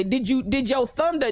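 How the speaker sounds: ordinary delivery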